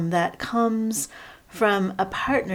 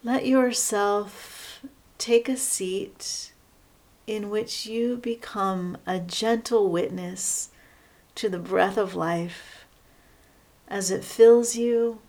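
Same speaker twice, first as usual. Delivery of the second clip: guided meditation. Real, not acted. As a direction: guide them unhurriedly into settling, leaving space between phrases.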